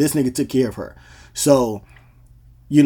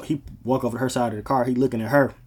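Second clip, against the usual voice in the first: ordinary talk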